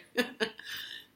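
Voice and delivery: breathy terrified giggle; nervous laugh. Real, not acted. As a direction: let out a jittery nervous laugh. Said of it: "That's the nervous laugh you do".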